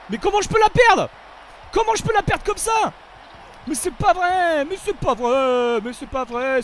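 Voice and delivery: high furious yell at losing the ball; angry shout. Real, not acted. Gamer yelling at his own lost ball, furious shout. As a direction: scream it in disbelief at the game.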